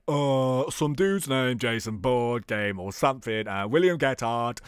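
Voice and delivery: low put-on bomb seller voice; Bobo. Bomb seller naming buyers to the detective, low character voice. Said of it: "he has to talk a little bit like this".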